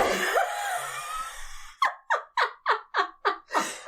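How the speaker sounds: high shrieking laugh; long squealing fit of laughter; helpless laughter. Real, not acted. Breaks up laughing at own joke.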